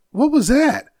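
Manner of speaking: a daughter jolted by a strange pop; startled daughter. Retold or imitated. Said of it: "She goes".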